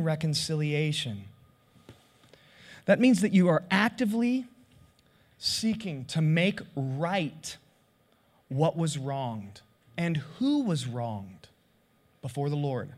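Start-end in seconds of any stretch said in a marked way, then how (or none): none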